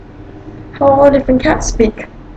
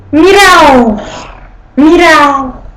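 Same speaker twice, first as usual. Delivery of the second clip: Italian cat meow; 2 semitones higher than usual. Shown as a demonstration. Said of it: "Italian cats".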